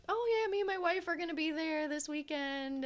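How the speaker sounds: retelling the convention fan's cheerful reply; imitating the guy. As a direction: pop up high and bright to mimic his excitement. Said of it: "And he's like".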